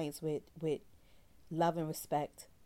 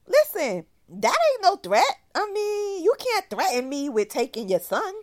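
defiant retort; 11 semitones higher, 9 dB louder than usual. Retold or imitated. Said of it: "So I was like".

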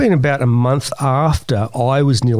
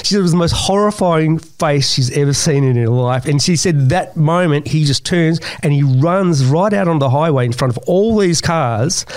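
ordinary talk

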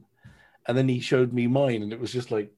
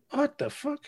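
shocked. Retold or imitated. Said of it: "And I'm thinking".

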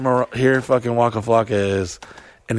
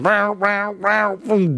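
imitating EDM beat; 10 semitones higher, much slower than usual.

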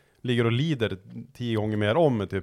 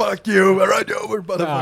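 high, loud hammed-up shout; hammed-up angry driver shouting at activists; mock yell; 10 semitones higher, 7 dB louder than usual. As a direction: bellow it like a furious driver.

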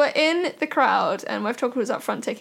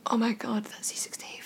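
imitating a starstruck whisper; awed whisper; 3 semitones lower, 10 dB quieter than usual. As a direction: whisper it furtively, very softly. Photographer's hushed aside about star backstage.

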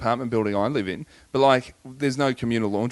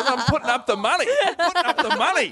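mum's insistence. Retold or imitated. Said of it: "She goes".

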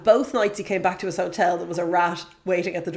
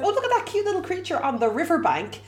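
admiring coo; 7 semitones higher than usual. Retold or imitated. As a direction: coo over a cute little animal. Retells admiring a cute animal.

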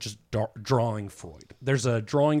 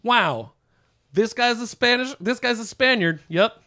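mock Freud; 3 semitones higher than usual. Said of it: "he said something like".

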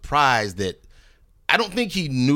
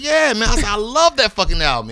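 higher, louder eager agreement; excited fan; 10 semitones higher, 4 dB louder than usual. Retold or imitated. Rapper to the label exec, higher and eager. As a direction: gush like a young fan.

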